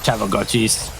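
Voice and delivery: put-on low, grumbling old man voice; grumpy old man. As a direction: grouse like a cranky grandpa. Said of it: "I'm probably gonna be a grumpy old man".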